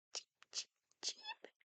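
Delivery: tiny whispered cheeps; cheeping. Retold or imitated. Grandma peeps softly for the child like a chick. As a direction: peep like a little chick.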